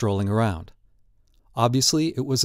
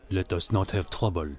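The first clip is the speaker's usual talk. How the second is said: low, soft warning in character; gallery owner; 5 semitones lower, 7 dB quieter than usual. A narrator's reading. Gallery owner warning the intruder off, low and quiet. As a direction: keep it down, controlled and discreet.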